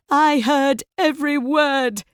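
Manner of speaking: high, loud furious scream. A narrator's reading. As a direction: scream it out in betrayed rage. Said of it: "she screams".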